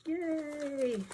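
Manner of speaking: a high, happy little yay; delighted squeal of yay; high cheer. Real, not acted. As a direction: cheer as the total comes out right. Total reached, cheering.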